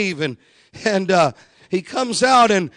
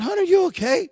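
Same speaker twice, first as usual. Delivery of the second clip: worried husband asking; 12 semitones higher, faster than usual. Retold or imitated. Husband asks dying wife.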